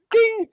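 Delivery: mock ding. Mimics a bell sound.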